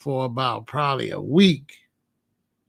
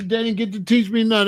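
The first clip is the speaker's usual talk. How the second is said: normal talking voice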